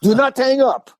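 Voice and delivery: high, emphatic imitated order; commanding caller. Caller on the third try stops a hang-up, acted command.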